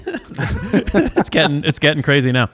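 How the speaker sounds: high, loud laugh through words. Real, not acted. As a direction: laugh while you say it. Laughing as candy gets thrown.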